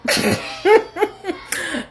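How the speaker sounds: high laugh before speaking. Real, not acted. Laughs before unsettling punchline.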